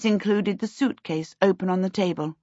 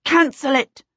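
high, sharp shouted command; raised, commanding cry; urgent shout; 7 semitones higher, 4 dB louder than usual. A narrator's reading. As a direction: shout it as an emergency order. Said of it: "she shouted".

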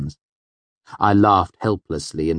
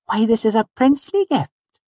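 very high, delighted, louder voice; Auri; 5 dB louder than usual. A narrator's reading. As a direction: marvel at a gift in a dainty high voice. Auri admires the bottle.

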